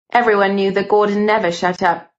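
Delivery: ordinary delivery, nothing marked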